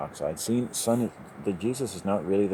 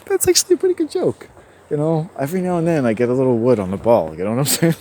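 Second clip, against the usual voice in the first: loud, laughing self-praise; 6 semitones higher, 10 dB louder, faster than usual. Real, not acted. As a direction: crow about the joke through laughter.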